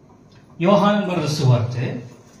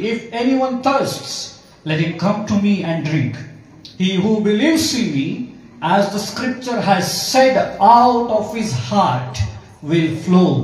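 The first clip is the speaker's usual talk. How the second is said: loud, slow scripture proclamation; ಕೂಗಿ ಹೇಳುವುದು; 6 dB louder, much slower than usual. Acted. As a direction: cry it out to the crowd, word by word. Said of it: "Jesus stood and cried out". (ಜೋರಾಗಿ, ನಿಧಾನವಾಗಿ ಕೂಗಿ ಸಾರುವ ಧ್ವನಿ)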